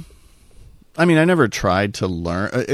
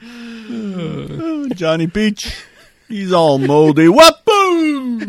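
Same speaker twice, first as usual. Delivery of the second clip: much higher, louder goofy character; silly cartoon voice; 13 semitones higher, 7 dB louder, much slower than usual. Joking about Johnny Peach in a goofy high voice.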